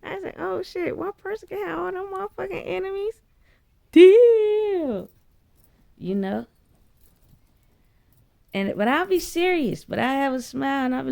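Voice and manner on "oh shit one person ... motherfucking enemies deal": high and fast, then a loud "Deal"; incredulous then defiant. Retold or imitated. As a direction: shrug it off, then slam the deal. Accepting the fight in disbelief.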